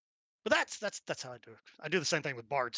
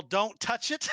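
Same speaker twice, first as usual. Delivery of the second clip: yelled warning; 7 semitones higher, 9 dB louder, slower than usual. Acted. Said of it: "use a free action to yell".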